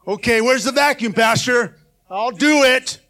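grudging volunteer. Pretend. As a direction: play the reluctant helper griping about chores. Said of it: "be all complaining about it".

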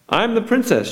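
high, proud announcement; haughty princess. A narrator's reading. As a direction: announce yourself like royalty. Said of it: "she announced".

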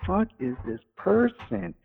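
mock appalled question; imitating charge nurse. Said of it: "the charge nurse is like".

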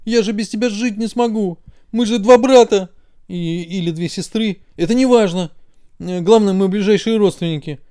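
a hint of hammed-up sobbing; mock crying plea. A narrator's reading. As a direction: left ear pleading with its brother not to go. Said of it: "заплакало левое ухо".

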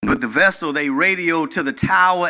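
normal talking voice

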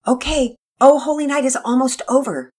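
put-on high, loud boy's announcement; boy announcing to siblings, excited. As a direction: tell everyone the song is ending. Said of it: "Pearson announced".

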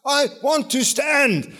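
pleading insistence. Retold or imitated. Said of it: "to get him to keep quiet".

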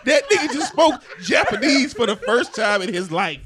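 shouting through laughter; laughing shout. Real, not acted. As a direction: yell it while cracking up. Hysterical laughter at friend's gibberish.

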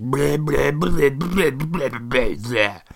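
string of high nonsense blahs; mock blathering. Imitating gossiping groups at the restaurant, mock blathering. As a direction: babble like empty chatter. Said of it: "Just sounds like piles of shit".

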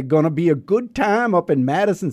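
normal talking voice

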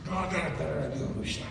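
soft, low and quick; David calming Hushai, soft and low. Acted. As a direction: hush him down gently. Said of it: "And David says".

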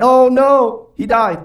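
a preacher acting out the frightened disciples; mock dismay. Disciples despairing at the crucifixion.

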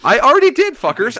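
loud, high comeback yell; exasperated shout. Real, not acted. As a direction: yell it back at them, fed up. Fires back at teasing cohosts.

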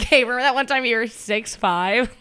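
mock dinner joke. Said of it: "it's like a dinner joke".

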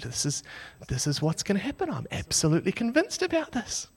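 soft, hushed earnest confession; a little emotional. Retold or imitated. Storyteller confiding in the youth pastor, softly emotional. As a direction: confide it quietly, on the edge of emotion. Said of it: "looking a little bit emotional".

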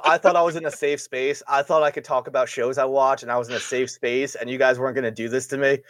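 normal talking voice

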